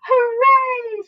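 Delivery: high, loud cheer. A narrator's reading. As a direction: whoop in triumph. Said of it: "shouted the old green grasshopper".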